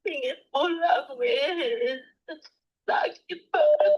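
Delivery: real tearful pleading; weeping through the words; crying. Real, not acted. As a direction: homesick and in pain, voice breaking into sobs. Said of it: "nggak usah nangis".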